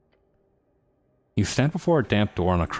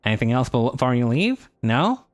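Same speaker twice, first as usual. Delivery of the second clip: higher, pushy nerdy merchant; smug bird shopkeeper, pitched up; 5 semitones higher than usual. Acted. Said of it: "a very nerdy voice".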